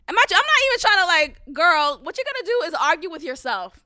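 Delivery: loud, high burst of disbelief; exasperated yell about the stylist; exasperated outburst. Real, not acted. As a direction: throw up your hands and shout.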